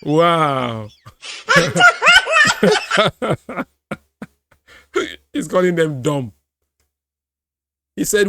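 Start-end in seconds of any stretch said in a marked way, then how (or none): 1.2-3.6 high, loud laughing fit; loud cackling laugh. Real, not acted. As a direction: burst out laughing uncontrollably. Laughing hard at clip.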